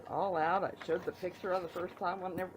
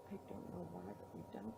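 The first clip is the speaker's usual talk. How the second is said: hushed aside; 10 semitones lower, 14 dB quieter than usual. Real, not acted. Aside about dragon.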